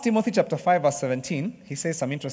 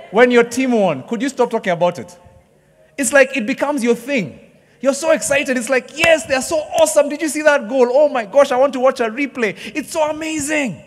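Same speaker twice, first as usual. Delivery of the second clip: much higher and louder, gushing fan; excited fan; 9 semitones higher, 8 dB louder than usual. Retold or imitated. Fan raving to friends after a win, excited. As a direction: gush like a thrilled football fan.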